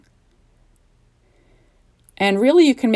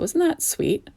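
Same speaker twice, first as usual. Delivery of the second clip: high, softened gush; cutesy coo; 9 semitones higher, 4 dB quieter, slower than usual. Real, not acted. Crafter admiring her corn gnome, high cooing.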